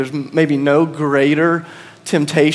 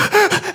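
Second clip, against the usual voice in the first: sharp high gasp for air; out-of-breath gasp. Retold or imitated. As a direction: gasp like you just ran back. I'm out of breath.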